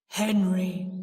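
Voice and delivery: loud, deep ghostly announcement; deep, haunting declaration. A narrator's reading. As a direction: intone the name like a spectre. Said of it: "in the same deep voice".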